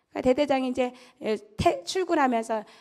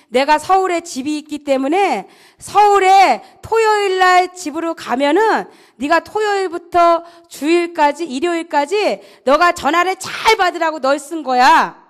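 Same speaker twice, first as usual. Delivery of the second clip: loud, slightly raised instructing voice; commander's orders; 3 semitones higher, 7 dB louder than usual. Retold or imitated. Commander explains the phone duty to the private, loud and firm. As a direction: spell out the duty firmly.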